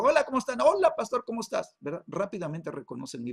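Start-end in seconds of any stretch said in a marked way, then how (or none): 0.0-0.6 high, loud friendly hello on the phone; cheery phone greeting. Retold or imitated. Pastor greets flock by phone. 0.6-1.8 high, loud delighted "Hola, pastor"; eager congregant reply. Retold or imitated. Congregant answers pastor on phone.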